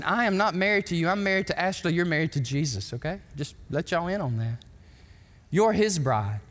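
normal talking voice

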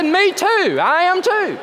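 high, emphatic agreement; eager agreement. Retold or imitated. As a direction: jump in with delighted agreement. Pastor agreeing in the story.

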